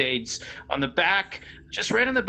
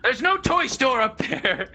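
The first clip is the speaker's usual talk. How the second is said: imitating mom putting an end to the begging; mock mom yelling; 8 semitones higher, slower than usual. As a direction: shut the kid down like an exasperated mom. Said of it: "my mom finally had to come out and go".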